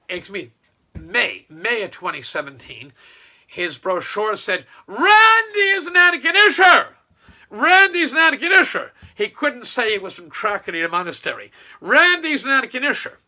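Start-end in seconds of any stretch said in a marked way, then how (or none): 4.9-8.9 very high, loud mock shout; mocking proclamation. Mockingly proclaims the brochure's slogan. 11.8-13.2 high, loud slogan shout; mocking proclamation. Slogan mockingly proclaimed once more.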